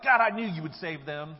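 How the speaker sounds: loud, exasperated complaint; indignant outburst, punched hard from the first word; mad Jonah. Acted. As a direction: throw it accusingly at God. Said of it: "I'm adding the inflection".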